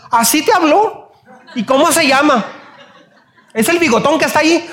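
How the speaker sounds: a mother outraged for her child, hammed up; mock angry mom. Mother gets angry too.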